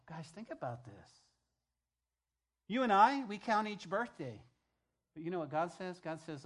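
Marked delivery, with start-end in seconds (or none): 2.7-4.4 preaching shout. Real, not acted. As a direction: shout it high and loud. Preacher building intensity.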